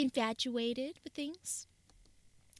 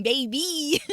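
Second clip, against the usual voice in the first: ordinary talk, nothing marked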